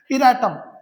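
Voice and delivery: इरेटम said loud and firm with a falling tune; headword called out. Shown as a demonstration. Teacher calls out इरेटम to the class. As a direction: set इरेटम apart as the new word. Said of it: "teacher announces headword".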